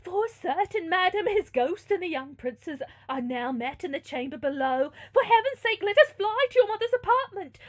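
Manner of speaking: high, loud breathless pleading; hammed-up frightened servant; panicked maid. A narrator's reading. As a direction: urge escape in rising alarm. Maid panics about ghosts.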